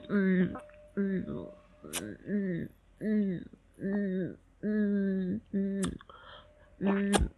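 closed-mouth humming moans; muffled hums. Acted.

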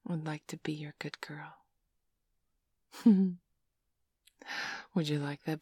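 soft, breathy-close whispering to a lover; sultry ASMR whisper; intimate whisper. Acted. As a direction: murmur it close to the mic, teasing. Whispered intimate roleplay throughout.

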